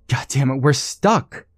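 rising alarm, pushed up high; half-panicked outburst, not fully there yet; near panic. Acted. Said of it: "nearing a state of panic".

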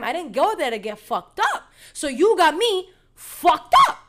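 retold offended comeback, rising in pitch and volume; indignant outburst. Indignant retort, building to outburst.

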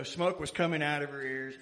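ordinary talk

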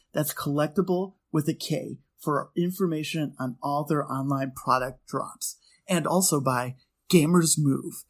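soft, even sponsor read. Acted. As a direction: read the sponsor spot smoothly.

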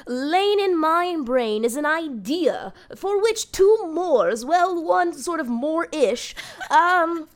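hammed-up old English delivery; mock Shakespearean. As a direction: play it like a pompous bard. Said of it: "opening of a Shakespeare play".

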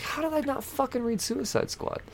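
high, disbelieving squeak at himself; shocked at his own mistake; shocked disbelief. Retold or imitated. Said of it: "I was like".

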